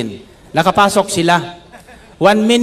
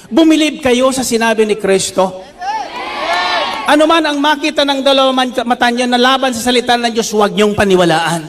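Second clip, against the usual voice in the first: fiery preaching; 5 semitones higher than usual. Acted. Pastor exhorting the congregation, fiery preaching. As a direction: preach it out, rising and pressing.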